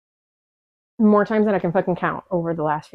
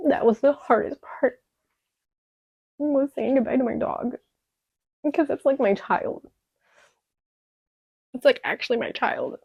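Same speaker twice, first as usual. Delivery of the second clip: choked up; 6 semitones higher than usual. Real, not acted. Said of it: "I'm still crying".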